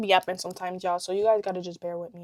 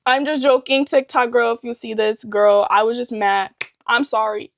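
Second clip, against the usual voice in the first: loud mock apology; 3 semitones higher, 7 dB louder than usual. Real, not acted. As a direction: sell a fake sorry.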